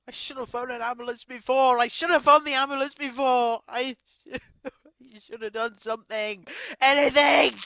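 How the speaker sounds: real distress spilling over; loud, tearful self-reproach; anguished self-blame. Real, not acted. Blaming herself after her death.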